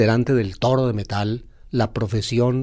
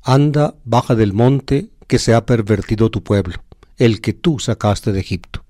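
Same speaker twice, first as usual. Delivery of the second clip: louder, solemn reading of the Bible text; scripture reading; 5 dB louder than usual. Acted. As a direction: proclaim the passage to the congregation. Homilist reads the Bible passage aloud. (lectura bíblica solemne y más fuerte)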